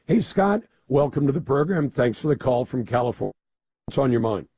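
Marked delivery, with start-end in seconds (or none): none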